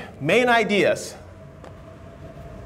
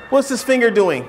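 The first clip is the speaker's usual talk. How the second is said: aggressive. Shown as a demonstration. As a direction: confront them on purpose to make them uneasy. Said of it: "it's so aggressive".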